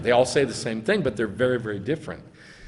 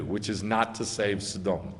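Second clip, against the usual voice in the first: ordinary delivery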